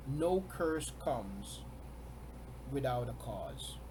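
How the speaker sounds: NO curse comes, then a pause before without a cause; slow emphatic repeat. Shown as a demonstration. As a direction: spell it out, very slowly. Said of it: "repeated slower, split".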